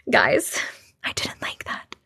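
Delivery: small, very soft whisper; unsettled whisper. Real, not acted. Reader confiding in viewers after a scary story, unsettled whisper. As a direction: whisper it, creeped out.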